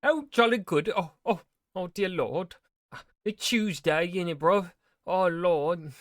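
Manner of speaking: high, loud posh British fluster; fussy Englishman clucking in alarm; British accent. Pretend. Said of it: "Concerned British noises".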